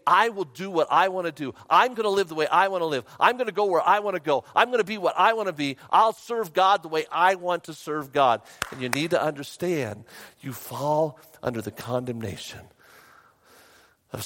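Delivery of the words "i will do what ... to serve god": loud, high, fast list of demands; mock defiance, quick and emphatic; self-willed insistence. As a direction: hammer out each stubborn claim. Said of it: "when we say".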